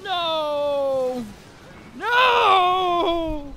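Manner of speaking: high, loud cry of dismay; anguished shout. Real, not acted. Gamer cries out at the screen in a loud shout. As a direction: yell out as the hit goes wrong. Attack misses in boss fight.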